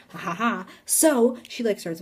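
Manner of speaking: ordinary talk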